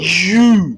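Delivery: यू called out with rounded lips. Shown as a demonstration. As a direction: call out यू, lips pushed forward. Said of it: "ये lips ऐसे move करेंगे".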